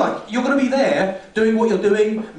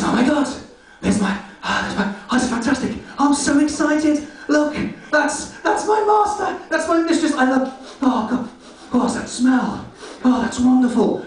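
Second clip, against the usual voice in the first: overjoyed dog; 5 semitones higher than usual. Acted. Excited dog voiced.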